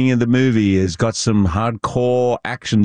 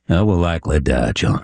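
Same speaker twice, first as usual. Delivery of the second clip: lower-pitched Yorkshire film-role voice; Yorkshire accent; 3 semitones lower than usual. Acted. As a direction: deliver it low, in Yorkshire dialect. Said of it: "the Yorkshire".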